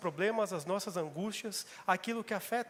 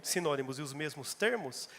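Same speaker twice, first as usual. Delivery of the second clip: ordinary talk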